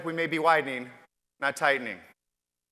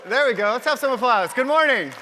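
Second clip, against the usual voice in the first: loud, higher rally call to the room; louder, higher hype greeting; calling out to the crowd; 5 semitones higher, 5 dB louder than usual. Real, not acted. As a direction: pump up the audience.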